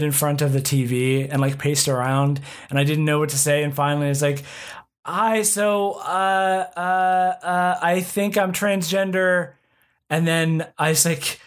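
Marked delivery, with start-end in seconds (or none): 5.0-9.5 imitating a high-pitched, halting coming-out; re-enacted nervous announcement, pitched up; nervous blurted confession. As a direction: blurt it out high and awkward, stalling on the uhs. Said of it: "went into the room and screamed it at my friends".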